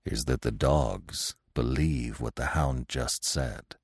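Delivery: put-on low, soft, very slow old stag. A narrator's reading. Old stag explaining the dogs to Bambi, slow hushed gravity. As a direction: weigh each phrase gravely and quietly. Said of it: "the old stag answered".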